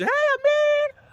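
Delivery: very high, loud drawn-out squeal; shrieky mock greeting; Tisha greeting. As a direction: imitate an over-eager hello, squealed way up high. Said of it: "and you hear Tisha".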